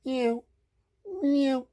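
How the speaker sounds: high little meow; short high-pitched mews; cat meow. A narrator's reading. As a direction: mew like a cat. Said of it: "says the cat".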